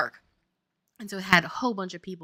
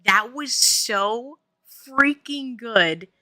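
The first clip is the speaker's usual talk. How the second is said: slow, loud and emphatic, each word stretched; retold amazed praise; 8 dB louder, much slower than usual. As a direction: imitate an awestruck crowd, drawing out every word. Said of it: "they said".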